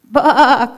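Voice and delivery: high, loud calling baa; loudest bleat. Acted. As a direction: bleat as loud as you can. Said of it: "called Daisy as loud as she possibly could".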